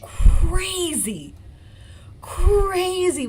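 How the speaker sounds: loud, high exclaim; excited outburst. Real, not acted. To viewers about the cross-examination, excited loud outburst. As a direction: blurt it out in amazement.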